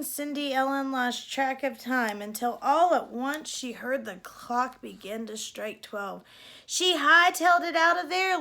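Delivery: ordinary delivery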